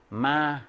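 마 held level at mid pitch; 마 with no rise or bend. Shown as a demonstration. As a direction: demonstrate Vietnamese 마 on a long, even tone. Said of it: "평성이야".

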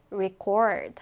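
"record" with the last syllable stressed, re-CORD; stress on second syllable. Shown as a demonstration. Teacher models the verb for students, stress on second syllable of "record". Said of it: "자, 동사는".

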